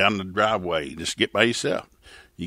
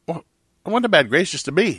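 pleading; 8 semitones higher than usual. Retold or imitated. Pastor pleads for daughter.

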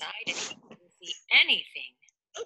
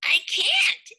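high, protesting fish voice; Rainbow Fish voice; 12 semitones higher, 5 dB louder than usual. Acted. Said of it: "started to say".

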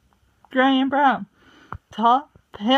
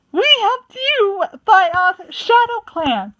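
high, loud, indignant cry; shocked protest; 10 semitones higher, 5 dB louder than usual. A narrator's reading. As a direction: protest in disbelief.

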